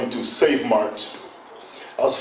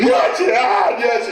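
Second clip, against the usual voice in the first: screaming in sleep; 7 semitones higher, much slower than usual. Retold or imitated. Dreaming of the graveyard shift, shouting a frightened yes. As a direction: jolt awake yelling yes in terror. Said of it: "woken up like five times screaming".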